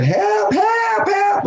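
desperate high screams for help; drowning man shrieking for rescue; screaming for help. Retold or imitated. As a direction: scream help at the top of your lungs, pitch cracking high. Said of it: "So I start screaming".

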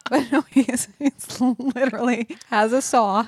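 real giggle through the words; laughing while talking. Real, not acted. Explaining the saw-on-a-log joke, laughing.

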